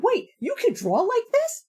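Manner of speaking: high, slow incredulous question; astonished. Retold or imitated. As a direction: stretch it out in mock disbelief. Critic sees life drawings.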